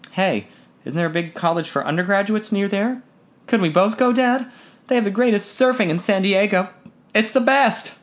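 put-on a boy pushing his own plan; eager son. A narrator's reading.

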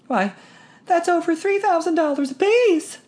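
put-on high, astonished girl's voice; a young daughter who can't believe the sum; incredulous. A narrator's reading. Daughter to her father about the money, high and incredulous. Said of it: "Trixie said incredulously".

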